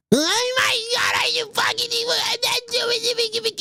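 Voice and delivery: very high, soft mocking squeal; hammed-up tiny-voiced meltdown, drawn out; mock desperate whining. Mocks a desperate, upset Batgirl.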